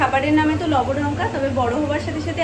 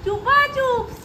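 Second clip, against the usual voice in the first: imitating the child; 7 semitones higher, slower than usual. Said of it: "কি সুন্দর বলছে".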